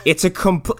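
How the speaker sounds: ordinary talk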